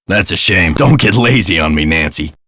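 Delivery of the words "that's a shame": ordinary delivery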